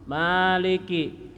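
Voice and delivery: Maliki with the first vowel drawn out long. Shown as a demonstration. Teacher demonstrating long Maliki to students. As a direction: stretch the ma of Maliki slowly. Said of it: "di sini kita panjangkan".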